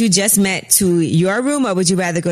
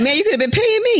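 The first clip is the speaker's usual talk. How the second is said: mocking quote; 11 semitones higher than usual. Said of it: "You're like".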